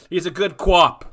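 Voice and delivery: loud Boston co-op; Boston accent. Retold or imitated. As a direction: say cop the Boston way. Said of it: "That's your Boston accent".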